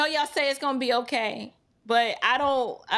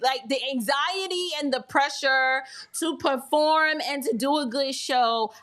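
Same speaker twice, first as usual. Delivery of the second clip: ordinary talk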